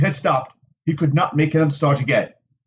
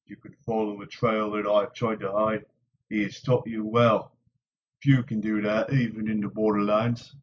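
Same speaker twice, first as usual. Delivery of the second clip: low, soft, approving warrior; quiet respect from a hard man; gruff Warder; 5 semitones lower, 6 dB quieter than usual. A narrator's reading. Lan praising Nynaeve's tracking, low and soft.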